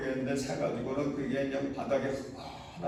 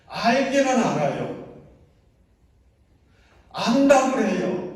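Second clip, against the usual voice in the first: emphatic preaching; 7 semitones higher, 9 dB louder than usual. Real, not acted. Preacher emphasising knowledge vs faith.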